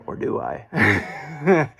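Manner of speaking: mock spooky tease. Teasing about a hairy beast.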